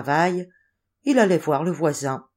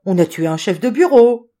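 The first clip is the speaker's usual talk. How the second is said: loud, higher-pitched outcry; acted cry of alarm; startled exclamation; 5 semitones higher, 9 dB louder than usual. A narrator's reading. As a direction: cry out as if hearing shocking news. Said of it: "s'écria".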